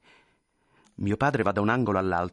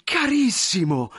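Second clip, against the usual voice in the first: high, loud, drawn-out endearment; slow, delighted exclamation; pleasantly stunned; 10 semitones higher, 5 dB louder, slower than usual. Acted. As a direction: gush it at a dear old friend. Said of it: "piacevolmente sbalorditi".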